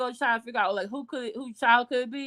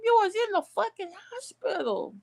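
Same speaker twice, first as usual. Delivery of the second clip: higher pitched indignant outburst; outraged; 9 semitones higher than usual. Real, not acted. Scolding the absent mother, indignant. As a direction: throw it at her in disbelief.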